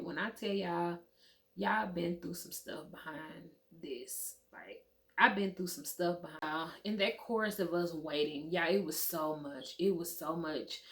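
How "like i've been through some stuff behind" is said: usual speaking voice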